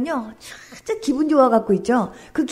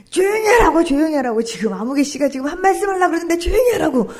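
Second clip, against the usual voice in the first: imitated loud hushing; 3 semitones higher, 4 dB louder than usual. Professor silencing the group for the husband to speak, loud. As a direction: call over the chatter, insistently.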